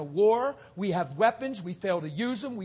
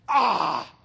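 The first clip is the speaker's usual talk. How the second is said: ecstatic cry; 3 semitones lower than usual. Real, not acted. Preacher's ecstatic outburst.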